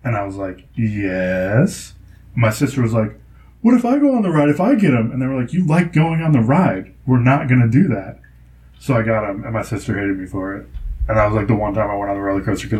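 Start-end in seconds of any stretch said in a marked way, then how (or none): 1.1-1.9 drawn-out yes sliding up from low; tempted agreement. Retold or imitated. Child answering parents' bribe, tempted agreement. 3.6-5.1 high-pitched mock child's plea; pleading sister. Imitating sister bargaining with parents, high pleading. 5.5-8.2 higher, emphatic mock refusal; dismissive parent. Imitating parents refusing sister's offer, emphatic dismissal.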